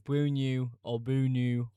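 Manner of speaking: loud, slow "boo new or boo new"; "boo new" drawn out loudly, twice; exaggerated pronunciation attempts. Shown as a demonstration. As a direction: offer "boo new or boo new" as two uncertain attempts. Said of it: "whatever Orange Apple says".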